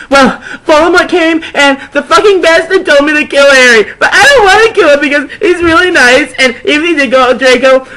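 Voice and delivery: high, loud hammed-up wail of distress; overacted teen despair, fast; tearful rant. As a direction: melodramatic goth girl complaining. Distressed heroine pours out troubles.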